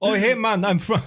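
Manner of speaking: high, hammed-up Jamaican greeting; mock Jamaican accent. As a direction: toss off a jokey Jamaican hey man. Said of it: "that was Jamaican".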